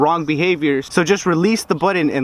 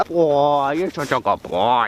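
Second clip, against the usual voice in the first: talking to a dog; 4 semitones lower, slower than usual. Real, not acted. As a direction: coo at the good dog. Praising dog.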